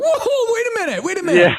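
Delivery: high-pitched, laughing backpedal; mock alarmed protest. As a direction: act taken aback, pitch leaping up. Acting out fans taken aback.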